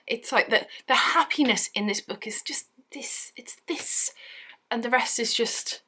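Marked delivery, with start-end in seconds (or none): 2.0-4.1 breathy mock whisper about the tiny bit of joy; hushed, conspiratorial whisper. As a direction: whisper it like a secret. Mocking how little happiness.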